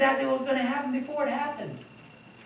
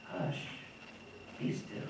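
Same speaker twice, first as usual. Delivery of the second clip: soft hush; 7 semitones lower, 18 dB quieter than usual. Retold or imitated. Jesus calms the storm.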